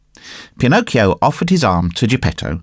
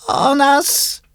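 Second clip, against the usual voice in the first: echoing cat. Acted. Cat repeats the fox's word, high echoing voice. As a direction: pipe up with a high-pitched echo. Cat echoes the fox again.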